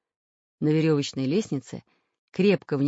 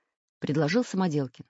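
ordinary delivery

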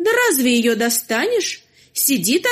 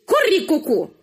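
rooster crow; 4 semitones higher than usual. Acted. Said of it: "самая страшная острастка".

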